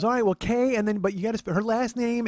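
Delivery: high, insistent imitated aunt; helpful aunt. Aunt reading names out to nephew, fussy aunt voice. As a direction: spell it out as the meddling aunt.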